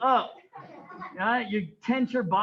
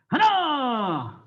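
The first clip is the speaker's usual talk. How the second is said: long, loud falling call; shouted count; 3 semitones higher, 6 dB louder than usual. Real, not acted. Instructor counting kicks for the class, shouted command. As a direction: bellow it out, stretched and falling.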